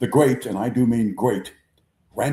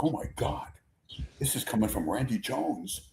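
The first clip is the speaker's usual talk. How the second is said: scared whisper; 9 dB quieter than usual. Retold or imitated. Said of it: "And Hal is like".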